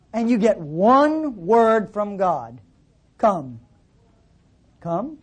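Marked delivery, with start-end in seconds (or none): 3.2-3.6 a single word dropped low and solemn; "Come." said low and weighty, like a divine call; solemn deep command. Acted. God's one word, repeated. 4.8-5.2 "Come." much softer, a gentle rising invitation; "Come." eased down and lifted at the end, tender. Acted. Same word, softened.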